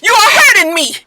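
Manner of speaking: high, loud pained yell; real-sounding panicked shout; frightened yelling. Retold or imitated. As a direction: cry out as if being hurt. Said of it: "she was just like".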